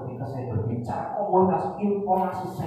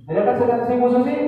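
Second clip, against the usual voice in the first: higher retake; 7 semitones higher, 7 dB louder, faster than usual. Shown as a demonstration. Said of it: "Mas, coba lagi".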